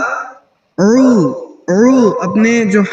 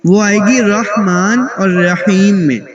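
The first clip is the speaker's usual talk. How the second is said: usual speaking voice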